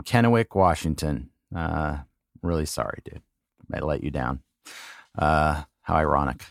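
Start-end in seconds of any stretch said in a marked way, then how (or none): none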